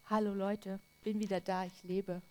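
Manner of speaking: low, soft and nonchalant; a shrug of a greeting; casual and offhand. Pretend. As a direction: toss it off like nothing happened. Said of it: "Das glaube ich nicht".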